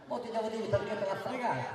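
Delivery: normal talking voice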